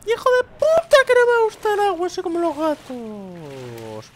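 very high loud squeal; high squealing creature voice; character protesting. Pretend. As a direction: squeal like a wet cat. Character protests about water.

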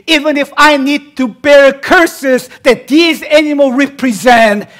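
loud, high, slow divine vow; God's oath. Acted. Preacher voicing God swearing to Abram, loud.

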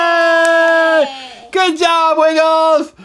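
drawn-out, high celebratory shout; excited cheer. Real, not acted. Parent celebrating Wiggles eating the treat, cheering. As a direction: let out a long, happy hooray.

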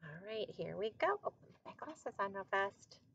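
ordinary delivery

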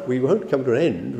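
usual speaking voice

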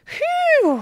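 high, loud breathless puff; panting phew. Acted. Panda escaping the peacock, puffing out of breath. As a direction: blow out after the chase. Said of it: "hijgde Panda".

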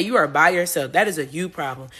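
ordinary talk, nothing marked

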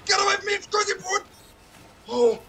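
high, loud yelp of alarm; panicked shout. Real, not acted. Streamer fleeing an attacker, panicked shout. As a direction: scream to keep them away.

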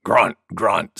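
low-pitched boar grunts; grunting wild boar. A narrator's reading. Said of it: "said Wild Boar".

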